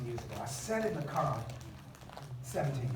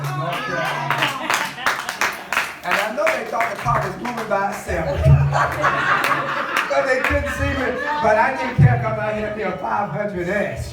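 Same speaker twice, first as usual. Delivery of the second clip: higher, louder laughing proclamation; exultant preaching; 6 semitones higher, 12 dB louder than usual. Acted. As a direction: shout the joy of the new car.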